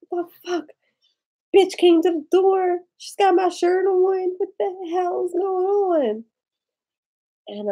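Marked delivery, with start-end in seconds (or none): 0.1-6.2 high, loud, indignant retelling; upset girlfriend complaining to her sleeping ex; outraged. Retold or imitated. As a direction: demand answers from the ex, pitch up.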